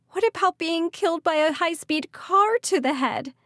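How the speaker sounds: high, loud frightened wail. Acted. Callie wailing to Malachi while running through the storm. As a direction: wail it in panic. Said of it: "Callie wailed".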